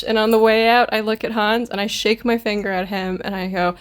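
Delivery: ordinary talk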